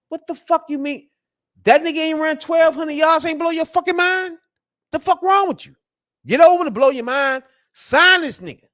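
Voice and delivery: high, loud, exasperated yelling; indignant outburst. Real, not acted. Fan yelling at doubters to sign the quarterback, indignant. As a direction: go off on them with the voice way up.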